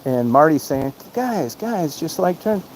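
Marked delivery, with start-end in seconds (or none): 1.1-2.6 higher, softer placating voice; imitating Marty pleading. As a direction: mimic Marty trying to calm them down. Marty calming a heated fight.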